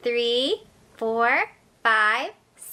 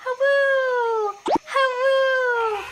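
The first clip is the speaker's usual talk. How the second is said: high, drawn-out trumpeting "hawoo"; pretend elephant trumpet; 7 semitones higher, slower than usual. Teacher playing elephant with children, high trumpeting call. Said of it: "pretend to be an elephant".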